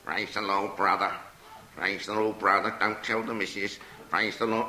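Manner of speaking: low parrot voice, well under the narration; talking parrot. Acted. Parrot repeating all it can say, low parrot voice. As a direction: squawk out stock phrases in a low register. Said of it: "the parrot was sitting there saying".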